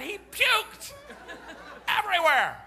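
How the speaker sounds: high, laughing burst. Real, not acted. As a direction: laugh out the punchline. Laughing at punchline.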